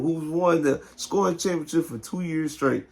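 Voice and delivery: normal talking voice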